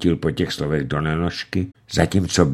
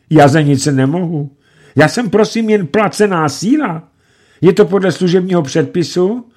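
high, louder, begging; pleading fright; 10 semitones higher, 5 dB louder than usual. A narrator's reading. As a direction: plead not to be shot. Scared clerk pleads at gunpoint. (vyděšeně prosit)

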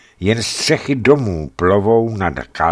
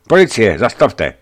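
loud, higher call to halt; police shout; 5 semitones higher, 7 dB louder than usual. Acted. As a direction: order the car to stop. Police stopping the car.

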